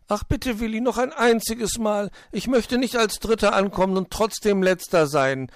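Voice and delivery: put-on high, loud childlike whine; whiny pleading. A narrator's reading. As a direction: beg and sulk at once. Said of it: "maulte Borstel".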